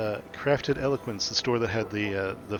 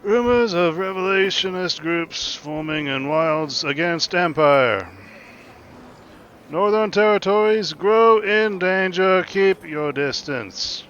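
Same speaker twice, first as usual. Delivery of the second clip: loud, high, drawn-out proclamation; ringing, high-pitched street herald's call; yelling town crier; 8 semitones higher, 9 dB louder, slower than usual. Acted. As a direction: cry the news slowly across a busy street. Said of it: "you hear somebody yelling".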